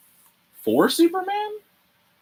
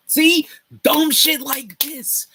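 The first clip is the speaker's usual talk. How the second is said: much higher, slow exasperated rant; 8 dB louder, much slower than usual. Real, not acted. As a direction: throw up your hands at it, high and slow. Ranting about bad resolution.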